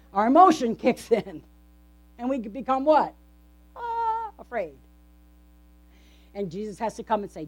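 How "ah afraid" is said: normal talking voice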